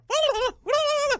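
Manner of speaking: high, loud shrieking cackle aimed at the bull; imitated bull-scaring shriek. Farmer showing how to scare off a charging bull, high loud shriek. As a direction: let out a sudden piercing high cackle. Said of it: "just give him that one".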